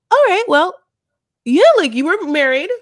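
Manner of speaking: loud, high-pitched mock comeback; imagined retort. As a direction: call them out, loud and high. Imagined blunt retort to the couple.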